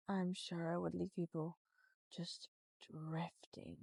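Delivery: hypnotic voice. Shown as a demonstration. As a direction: let the words sink away. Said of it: "If I were to do this by accident".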